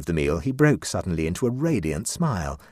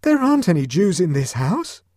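much higher, louder panicked question; gasped out; 12 semitones higher, 8 dB louder than usual. A narrator's reading. Huddle in panic to Clovis, high gasping question. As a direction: gasp it out high and loud. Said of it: "managed to gasp out".

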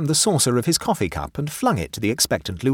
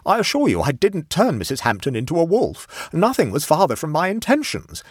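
raised, higher-pitched defensive protest; 9 semitones higher, 5 dB louder than usual. A narrator's reading. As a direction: protest your innocence urgently. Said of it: "he protested".

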